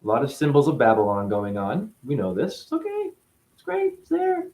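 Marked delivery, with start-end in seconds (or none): 2.6-4.5 much higher, sing-song mock approval; sarcastic falsetto approval; mock cheerful. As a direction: fake delight to ridicule it. Mocking the pope's symbols.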